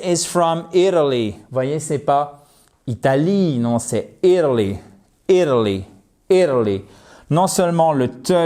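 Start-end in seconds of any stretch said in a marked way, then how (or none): none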